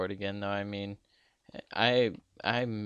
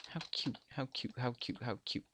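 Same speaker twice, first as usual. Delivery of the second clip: soft, repeated murmur; hushed gushing under the breath; quiet cooing; 2 semitones higher, 8 dB quieter than usual. Pretend. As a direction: coo it softly, over and over. Murmurs repeatedly over a cute player.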